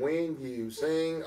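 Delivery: high, drawn-out mock Virginia twang; imitated Virginia accent. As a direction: stretch it very slowly, mimicking a Virginia accent. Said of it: "you got a Virginia accent".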